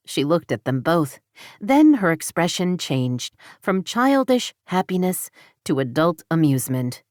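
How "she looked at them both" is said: ordinary delivery, nothing marked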